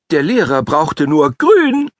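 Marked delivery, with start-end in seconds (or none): 1.3-1.9 high, loud command; called out. A narrator's reading. Teacher calling the dog back to its place, loud high call. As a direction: call the word out sharply. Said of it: "zu rufen".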